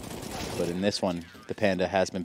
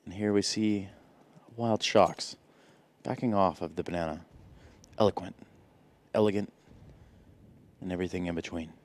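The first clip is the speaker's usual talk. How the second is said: lightly voiced Attenborough narrator; nature documentary; 8 dB quieter than usual. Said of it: "David Attenborough stuff".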